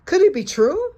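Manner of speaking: high fluttery goose voice; a lightly voiced startled goose; honking goose voice. A narrator's reading. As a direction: goose squawking in alarm. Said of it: "she honked".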